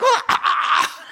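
high, drawn-out imitation shriek; mock possessed wail. Preacher imitating a girl in demonic manifestation, mock wail. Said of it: "demonic manifestation".

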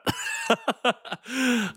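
high, soft self-mocking laugh; rueful laugh. Real, not acted. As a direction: laugh at your own bad luck. Laughs about losing fantasy matchup.

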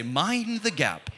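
slow, high British announcer voice; British accent. Shown as a demonstration. Preacher prompting the congregation to copy him, British accent. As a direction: draw it out in a put-on British accent. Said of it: "say it in a British accent".

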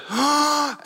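high sharp gasp coming up from underwater; sharp gasp for air. Retold or imitated. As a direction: gulp air like surfacing from a wave. Surfacing between waves in story.

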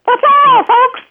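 very high falsetto farewell; falsetto jester. Retold or imitated. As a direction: squeak it out like a cartoon jester. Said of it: "with a falsetto like Mickey Mouse".

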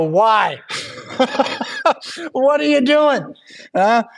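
high, laughing disbelief as an acted quote; incredulous outburst. Preacher voices sailors questioning fleeing Jonah, incredulous. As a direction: burst out baffled, pitch jumping up.

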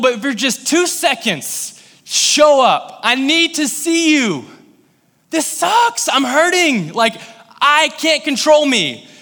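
high, loud, slow anguished plea; desperate pleading prayer. Retold or imitated. Preacher reliving his prayer in the car after arrest, anguished high cry. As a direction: cry out to God as if breaking down.

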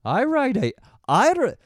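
mock German accent, cut off by laughter; starting a hammed-up German accent. Podcaster slipping into a mock German accent. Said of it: "I was about to do that German accent again".

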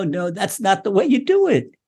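usual speaking voice

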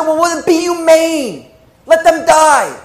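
high, urgent pleading; desperate plea. Retold or imitated. Sister begging the gatekeeper of hell for mercy.

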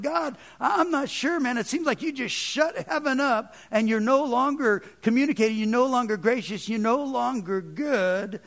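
high-pitched, pleading complaint to God; retold cry of someone feeling shut out; imitated crying out. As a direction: act out the psalmist's protest. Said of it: "as he's crying out to God".

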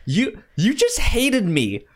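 usual speaking voice

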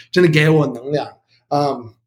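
ordinary talk

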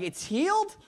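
very high, loud disbelieving question; incredulous. Retold or imitated. Preacher echoing news of the healing, incredulous. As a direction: play up stunned disbelief, voice leaping up.

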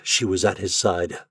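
ordinary delivery

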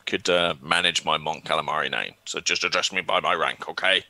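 lightly voiced old alien commodore; Mon Cal voice. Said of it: "shall I try a Mon Cal voice?".

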